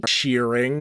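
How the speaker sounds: ordinary delivery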